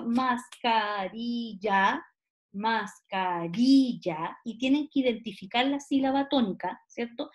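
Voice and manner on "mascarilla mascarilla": "mascarilla" very slow, each syllable stretched and separate. Retold or imitated. Teacher acting out the classroom syllable exercise, syllable by syllable. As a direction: sound out "mascarilla" as mas, ca, ri, lla. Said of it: "cuando hacen este ejercicio".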